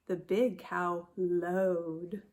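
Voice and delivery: slow mooed line; mooing read. Acted. Teacher reading the cow line like a moo. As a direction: stretch it like a lowing cow.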